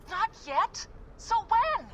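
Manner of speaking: rising, high, loud disbelief; exasperated. Acted. Deanna frustrated with Layla's stalling, exasperated. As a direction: push back, fed up and incredulous. Frustrated colleague pushes back.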